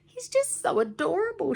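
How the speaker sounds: put-on high, swooning girl voice; gushing. A narrator's reading. Tiffany gushing about H Dude, high and swooning. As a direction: gush over him like a fan. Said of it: "Tiffany was gushing".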